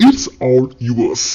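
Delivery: very slow, each word laid out for learners; slow teaching demo. Shown as a demonstration. Teacher modelling an English phrase for Malayalam learners, very slow. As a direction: spell the phrase out slowly so students can copy it. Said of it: "ഇതെങ്ങനെ ഇംഗ്ലീഷിൽ പറയാം?".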